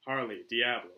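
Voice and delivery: usual speaking voice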